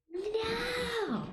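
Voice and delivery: high shouted "No!"; angry cry. Real, not acted. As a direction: cry out in outrage. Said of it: "I'm sorry, I get mad".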